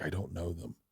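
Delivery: put-on very soft, low child's denial; quiet mock innocence. Daughter disowning parents to the lady, hushed and low. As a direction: drop the voice right down, imitating a child.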